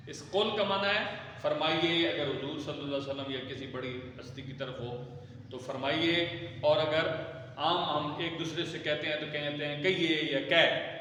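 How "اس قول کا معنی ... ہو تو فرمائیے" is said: normal talking voice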